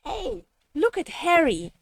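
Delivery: ordinary delivery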